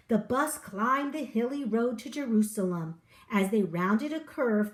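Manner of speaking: plain reading voice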